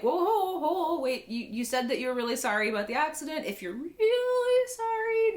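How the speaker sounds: high-pitched halting plea, stretching really; mock urgent appeal. He stops them before they go, mock urgent appeal. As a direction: call them back, then lean hard on really. Acts out stopping them leaving.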